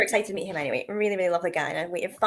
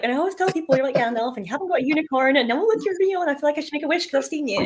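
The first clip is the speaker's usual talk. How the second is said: excited; 6 semitones higher, faster than usual. Retold or imitated. Fangirling at Ronnie Lee.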